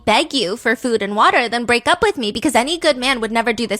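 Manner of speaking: loud retold angry rant; going off. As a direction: act out her heated outburst. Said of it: "So she goes off".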